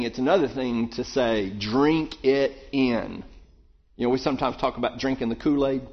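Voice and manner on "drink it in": drawn out slowly, word by word; slow, savoring. Retold or imitated. Preacher voicing a plea to drink it in, very slow. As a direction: let each word sink in slowly. Contrasting two kinds of asking.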